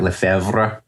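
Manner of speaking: Lefebvre said the French way, with a French r and a rising end; French accent. Shown as a demonstration. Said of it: "that's my attempt at a French accent".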